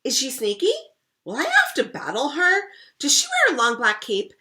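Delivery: rapid, high, loud eager questioning; excited questions. Acted. Rascal pestering her siblings with eager questions, high and loud. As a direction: fire off questions bursting with curiosity.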